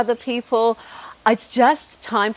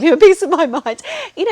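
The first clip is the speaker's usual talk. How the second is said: laughing; 10 semitones higher, 7 dB louder, faster than usual. Real, not acted. Laughing about scolding him nicely.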